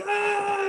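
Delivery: high excited scream. Retold or imitated. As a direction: shriek in shocked delight. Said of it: "I'm screaming".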